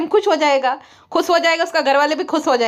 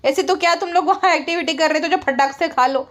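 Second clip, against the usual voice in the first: ordinary talk, nothing marked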